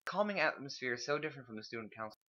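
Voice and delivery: normal talking voice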